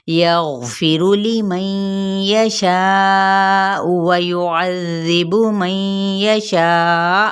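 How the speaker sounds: slow melodic tajweed chant; measured Quranic recitation. Acted. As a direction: recite it slowly, drawing out the long vowels.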